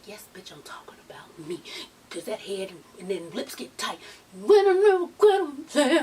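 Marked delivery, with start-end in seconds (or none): 0.1-1.6 voiceless whisper, much softer; whispered aside. Retold or imitated. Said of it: "she's like". 4.3-6.0 a touch of a cry in the voice; excited quote. Retold or imitated. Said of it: "repeating [name]'s line".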